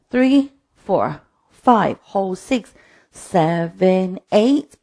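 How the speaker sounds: slow, punchy cues on the beat; line-dance instructor counting the class through. Real, not acted. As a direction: call the steps and counts in rhythm. Calls dance steps and counts.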